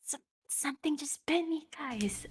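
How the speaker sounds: hushed, urgent whisper. Acted. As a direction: whisper a warning to your companions. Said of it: "whispering this".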